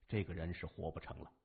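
ordinary delivery

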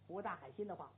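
ordinary delivery

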